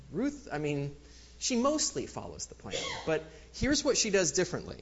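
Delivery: ordinary talk, nothing marked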